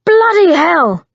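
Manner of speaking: sudden startled cry, rising loud on bloody hell; shocked outburst. Acted. Simon, struck in the greenhouse, cries out in shock. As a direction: yelp it out after the blow. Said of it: "Simon began".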